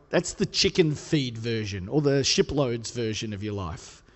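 ordinary talk

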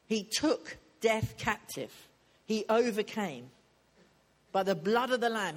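loud, slow preaching climax; fervent sermon climax. Acted. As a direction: proclaim it to the congregation. Preaching at full force.

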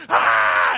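a loud, low guttural scream; imitated scream. Preacher re-enacting the hitchhiker screaming at him, loud scream. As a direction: scream like something possessed. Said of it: "and just screaming at me".

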